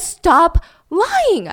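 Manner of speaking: high, loud reprimand; yelled order. Retold or imitated. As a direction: act out an angry detective shouting. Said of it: "they're screaming".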